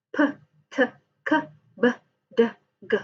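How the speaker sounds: quick separate bursts "p, t, k, b, d, g"; "p, t, k, b, d, g" as tiny explosions; plosive stops. Shown as a demonstration. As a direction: puff out each of "p, t, k, b, d, g" as a bare sound. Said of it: "it is called stops".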